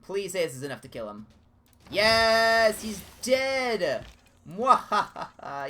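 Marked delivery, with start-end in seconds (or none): 1.9-4.0 triumphant shout. Real, not acted. Enemy unit killed, gloating. 4.4-5.7 hammed-up slow villain cackle; gloating mock evil laugh. Villain laugh after kill.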